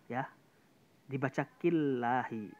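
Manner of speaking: ordinary talk, nothing marked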